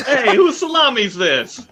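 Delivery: a slow, laughing mock Italian accent; Italian impression. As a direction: ham up a New York Italian. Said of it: "me impersonating my favorite Italian".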